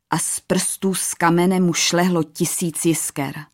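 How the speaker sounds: plain reading voice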